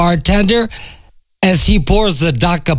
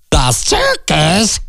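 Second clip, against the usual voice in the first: puzzled duck; slower than usual. Acted. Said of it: "The duck asks again".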